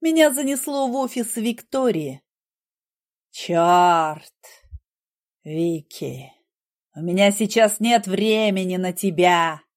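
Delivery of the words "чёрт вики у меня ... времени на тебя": through gritted teeth. A narrator's reading. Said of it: "процедил я сквозь зубы".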